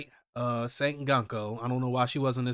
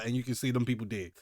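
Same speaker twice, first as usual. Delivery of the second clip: usual speaking voice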